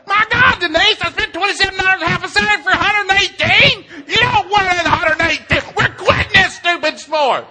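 high, hammed-up full-volume tirade; furious ranting. Dad complaining to his wife about wasted money, ranting. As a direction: yell it out, slowing on the final verdict.

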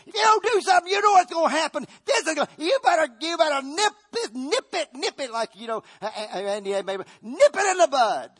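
high, loud, frantic urging; frantic imagination voice. Acted. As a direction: whip up panic, pushing to strike. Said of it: "next to memory sat imagination".

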